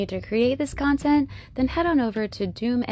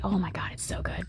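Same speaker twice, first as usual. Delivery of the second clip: fast excited whisper; 2 semitones lower, 8 dB quieter, faster than usual. Real, not acted. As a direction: gush it under your breath. Raving about coffee scent.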